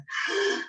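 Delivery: short demonstrated gasp; sharp intake of breath, acted surprise; surprised gasp. Shown as a demonstration. Teacher showing the face of surprise, surprised gasp. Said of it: "the face of surprise".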